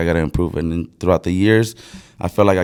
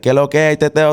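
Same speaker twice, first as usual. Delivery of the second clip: rapid-fire, higher Dominican Spanish; Dominican accent; 7 semitones higher than usual. Shown as a demonstration. Showing friends how Dominicans talk, fast Dominican accent. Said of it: "And then Dominican's like".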